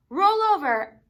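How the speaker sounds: high, loud command to a pet; called command. Acted. Child shouting a trick command to the sloth, high and loud. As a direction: call it out like a trainer.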